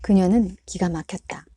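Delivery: ordinary delivery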